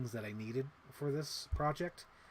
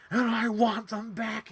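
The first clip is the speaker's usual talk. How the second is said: higher, louder and slow, dramatic movie delivery; imitating the trailer line; 7 semitones higher, 7 dB louder, slower than usual. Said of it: "where she's like".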